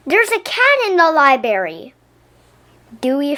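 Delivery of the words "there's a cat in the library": a loud, higher alarmed shout; a child yelling in alarm. Acted. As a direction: call it out to the whole room. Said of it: "Someone shouted".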